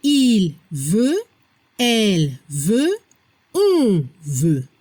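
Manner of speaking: "il veut", "elle veut", "on veut" slowly separated, stepping down; pronunciation model. Shown as a demonstration. Teacher modelling third person for learners, careful low "il veut", "elle veut", "on veut". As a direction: spell out by ear "il veut", "elle veut", "on veut", final T silent. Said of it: "La pronunciación".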